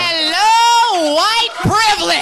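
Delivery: high, loud, slow mock outrage; loud mocking exclaim. Real, not acted. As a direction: call it out loudly.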